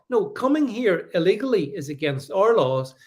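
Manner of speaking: ordinary talk, nothing marked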